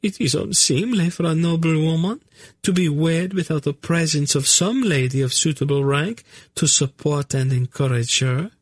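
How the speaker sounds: high, prim noblewoman; high, courtly objection; high lady voice. Acted. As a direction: insist on propriety like a fussy lady. Narina insisting on propriety.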